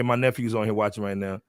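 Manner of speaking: ordinary delivery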